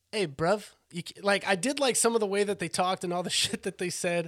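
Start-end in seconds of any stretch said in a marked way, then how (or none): 0.1-0.7 light London slang greeting; London accent. Retold or imitated. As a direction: toss off a cockney hey bruv. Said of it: "you get your London accents".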